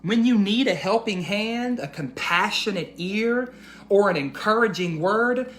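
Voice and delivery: put-on slow, gentle storybook reading for little kids; reading to children. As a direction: read it like a bedtime picture book to the class. Reads picture book to children.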